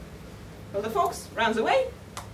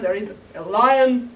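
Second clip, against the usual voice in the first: ordinary delivery, nothing marked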